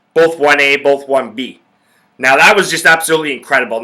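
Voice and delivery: usual speaking voice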